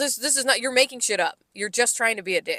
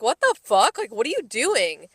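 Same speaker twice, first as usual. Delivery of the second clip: shocked exclamation; 3 semitones higher than usual. Retold or imitated. Coworker retelling her stunned reaction to a rude comment, shocked. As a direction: blurt it out as if caught off guard by a rude coworker. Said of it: "I was like".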